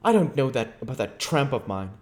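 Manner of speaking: a bitter, driven scientist telling his tale; lightly voiced, brooding fugitive; a hint of restless menace; Invisible Man.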